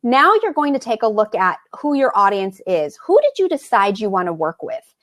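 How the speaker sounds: ordinary delivery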